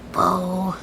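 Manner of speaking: usual speaking voice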